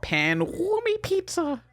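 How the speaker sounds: very high, slow squeaky squid voice; high cartoon squid voice; high squid kid voice. Squid kid name in silly voice.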